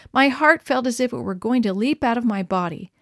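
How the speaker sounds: plain reading voice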